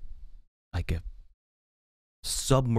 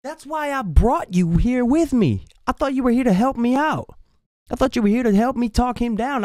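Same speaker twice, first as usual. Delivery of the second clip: exasperated; 13 semitones higher than usual. Retold or imitated. Complaining to the brother who gave no help, high and exasperated. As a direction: whine at the brother who let you down.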